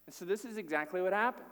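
ordinary talk, nothing marked

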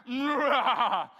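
big, loud, drawn-out "mwah" of wanting; greedy mwah noise. Acted. Preacher voicing our inward craving, loud greedy noise. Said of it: "what we're saying inwardly".

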